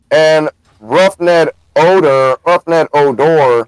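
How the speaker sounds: Roughneck Odor drawled slow and anglicized; slow anglicized announcer "Roughneck Odor"; mock English broadcast. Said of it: "everybody says the phonetic one".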